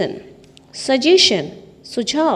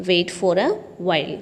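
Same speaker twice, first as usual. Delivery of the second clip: drawn out, every word of "wait for a while" slow and lower; slow teaching repeat; 4 semitones lower, much slower than usual. Shown as a demonstration. Teacher drilling students on an English phrase, slow and clear. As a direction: spell it out slowly for learners. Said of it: "teacher repeats phrase slowly".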